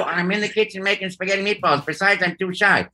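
voiced as Luigi calling from the kitchen.

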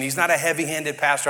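ordinary talk

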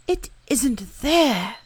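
a little bear breaking the news; earnest bear. Acted. Pooh tells Eeyore.

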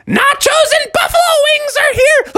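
very high, sing-out mom shout; high-pitched hollered announcement; Froggy's mom announcing. Acted. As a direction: call everyone to food in a piercing falsetto. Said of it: "and she said".

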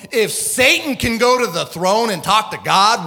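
ordinary talk

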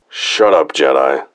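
mildly angry guard's order; brusque military put-down; curt trooper. A narrator's reading. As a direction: shut the prisoner up, falling at the end. Trooper snaps at prisoner.

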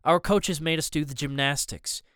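ordinary delivery, nothing marked

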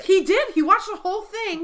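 high and loud, bursting out; excited. Real, not acted. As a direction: blurt it out eagerly. Eager correction of co-host.

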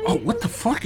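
shocked. Retold or imitated. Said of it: "I was like".

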